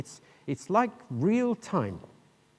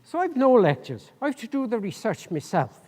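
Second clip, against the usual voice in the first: light imitation of an Irish accent, a little higher; 7 semitones higher, 4 dB louder than usual. Lecturer imitates a Limerick student, light Irish accent. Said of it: "I'll try and do it in an Irish accent".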